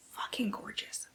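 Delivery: hushed, breathy aside about the covers; whisper. Real, not acted. Booktuber admiring the book covers, whispering. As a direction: whisper it like a guilty secret.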